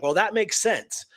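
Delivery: ordinary talk, nothing marked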